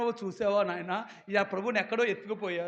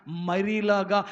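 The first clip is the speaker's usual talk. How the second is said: loud, emphatic sermon climax; preaching at full force; 2 semitones lower, 10 dB louder than usual. Real, not acted. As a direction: drive the promise home to the congregation at full volume. (గట్టిగా, నొక్కి చెప్పే ప్రసంగం)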